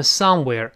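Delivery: "somewhere" with strong SOME, falling on "where"; stress on first syllable. Shown as a demonstration. Teacher has learners read aloud, "somewhere" stressed on "some". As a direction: hit the "some" in "somewhere", let it fall. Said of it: "重音在前面，".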